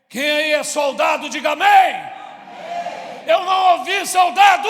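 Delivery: shouted call to the crowd; rallying shout. Real, not acted. Calling the congregation to answer.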